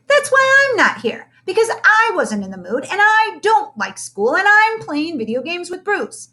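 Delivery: put-on high rapid kid's rant; excited cry. A narrator's reading. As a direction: pile up every excuse in a rush. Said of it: "cried Lenny".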